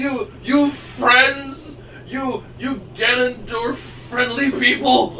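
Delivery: loud, slow, high mock-villain cry; booming villain accusation. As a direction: thunder the accusation out, dragging it. Boss voice mockingly accusing.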